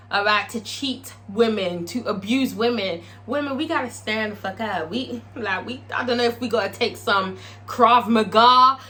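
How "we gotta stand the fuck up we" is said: ordinary talk, nothing marked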